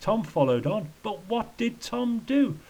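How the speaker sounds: high, mildly anguished recital; acted lament rising at the end; shrieked lament. A narrator's reading. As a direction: lift it into a high complaint. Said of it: "he shrieked".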